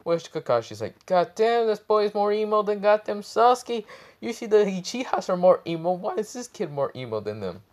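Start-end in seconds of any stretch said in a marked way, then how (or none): 1.1-7.6 high-pitched, hammed-up exasperation; mock-incredulous squeal easing down at the end; exasperated disbelief. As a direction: exclaim it way up high, baffled. Kakashi's exasperated reaction, acted.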